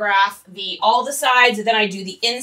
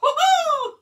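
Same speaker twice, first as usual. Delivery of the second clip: much higher, squeaky cartoon cheer; Mickey Mouse whoop; 3 dB louder than usual. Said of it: "I can do a Mickey Mouse impression".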